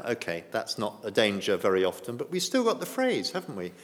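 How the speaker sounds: usual speaking voice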